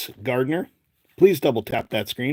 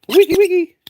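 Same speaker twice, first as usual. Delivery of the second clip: a sudden, much higher put-on squeak; silly squeaky call; 9 dB louder than usual. Card opener squeaking a silly cheer at the pack, much higher. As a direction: squeal it like a cartoon.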